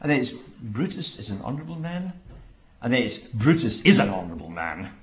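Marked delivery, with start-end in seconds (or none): 0.3-2.1 much softer, questioning lift on MAN; doubting inflection. Shown as a demonstration. Preacher showing the tone slide from praise, doubting inflection. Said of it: "the very tone takes him". 3.2-4.9 damning irony. Shown as a demonstration. Preacher showing how tone destroys Brutus, damning irony. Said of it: "to throwing him in the dustbin".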